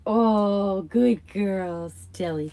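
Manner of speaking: pet praise. Real, not acted. Praising cat again.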